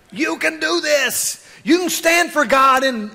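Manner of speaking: high, stretched-out rallying call; preacher's drawn-out encouragement; rousing cheer. Acted. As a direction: cheer them on from the stands. Cheering on the young people.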